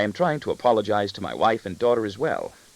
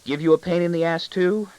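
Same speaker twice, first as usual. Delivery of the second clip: put-on high child's teasing question; bold, mischievous high voice; impish boy; 9 semitones higher, 4 dB louder than usual. A narrator's reading. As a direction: push your luck impishly, voice up. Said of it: "glitters with impish intent".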